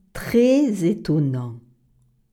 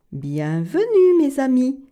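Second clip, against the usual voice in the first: ogress welcoming; 7 semitones higher, 4 dB louder than usual. Acted. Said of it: "dit l'ogrette Cordon Bleu".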